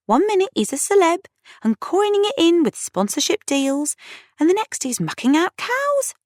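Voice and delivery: very high-pitched excited rant; incredulous. A narrator's reading. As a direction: marvel at a star turned farmer. Friend rants in disbelief.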